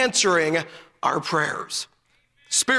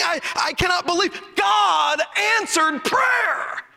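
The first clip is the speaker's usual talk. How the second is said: amazed disbelief; 7 semitones higher, slower than usual. Retold or imitated. Preacher retelling his amazed reaction.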